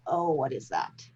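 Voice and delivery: normal talking voice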